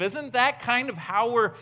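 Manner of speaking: ordinary talk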